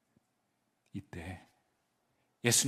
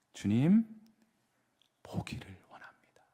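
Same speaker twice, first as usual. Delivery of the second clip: whisper; 5 semitones lower, 3 dB quieter than usual. Retold or imitated. Blind man's plea.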